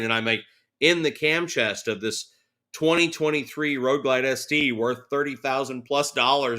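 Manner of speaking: ordinary delivery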